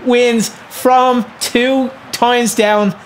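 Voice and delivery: slow, drawn-out words with gaps; halting, emphatic victory speech; talking in pauses. Real, not acted. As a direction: savour the win word by word. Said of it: "You can stop talking in pauses".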